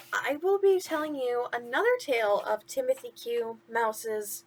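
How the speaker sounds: ordinary delivery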